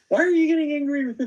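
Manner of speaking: high, fast incredulous protest; indignant disbelief. Retold or imitated. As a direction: retell it as a baffled outburst. Retold confrontation over being doubted.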